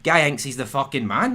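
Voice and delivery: ordinary talk, nothing marked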